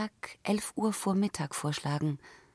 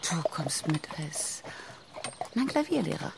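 whispering. Acted. Secret plan in Paris.

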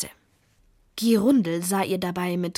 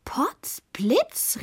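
higher, mocking exclamation; scornful; 8 semitones higher than usual. A narrator's reading. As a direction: call it out in mock amazement. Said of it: "rief Gerundel hohnlachend".

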